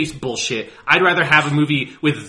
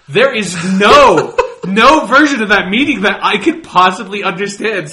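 laughing through the words; can't help laughing; 5 semitones higher, 6 dB louder than usual. Real, not acted. Laughing at absurd idea.

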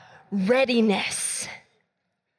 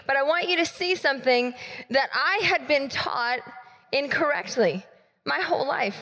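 ordinary talk, nothing marked

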